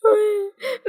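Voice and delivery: high, rising sob. A narrator's reading. Daughter cries harder at her mother's refusal, high wailing sob. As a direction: break into a bigger wail. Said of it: "Chị Hạ khóc to hơn".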